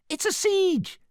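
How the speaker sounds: very high alarmed squeak; tiny panicked gingerbread man voice. A narrator's reading. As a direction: squeal the warning. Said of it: "squeaked the gingerbread man".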